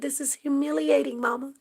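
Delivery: high, loud upset daughter voice; a grown daughter telling her mother it's humiliating; daughter's plea. Retold or imitated. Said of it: "She said".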